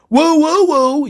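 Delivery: high, loud woo calls; hammed-up woo chant; mock woo. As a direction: whoop like a wrestling crowd. Mocking the catchphrase.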